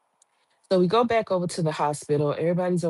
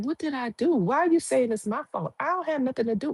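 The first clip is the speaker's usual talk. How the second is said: clueless whine; 8 semitones higher, faster than usual. Retold or imitated. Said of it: "Tiff is like".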